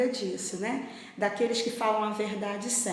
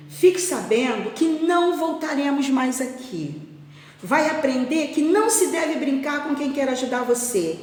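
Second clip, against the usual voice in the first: higher, louder stern telling-off; mildly furious scolding; 6 semitones higher, 4 dB louder than usual. Acted. Farmer scolding the boy who tricked him, mildly angry. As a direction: lay down the law, understated anger. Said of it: "ele ficou muito furioso e muito triste".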